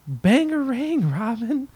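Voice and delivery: high, slow Bangarang shout; movie quote. Retold or imitated. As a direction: chant Bangarang like Rufio's gang. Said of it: "my brain went".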